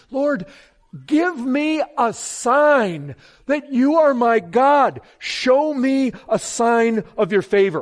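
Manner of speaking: a preacher praying aloud before his congregation; earnest prayer. Acted. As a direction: pray it from the heart, rising at the end. Prays aloud to God.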